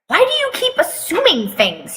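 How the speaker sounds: high, put-upon whine; whining. Acted. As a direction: complain like a sulky teen. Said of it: "he whines".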